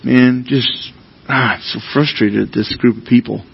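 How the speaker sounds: low, muted grumble under the breath; grumbling. Acted. Preacher acting out his own complaints about people, low frustrated grumbling. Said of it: "where I start grumbling".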